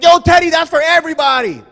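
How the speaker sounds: high, loud mock outrage; indignant scolding. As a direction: call out a greedy guest. Acted scolding of greedy guest.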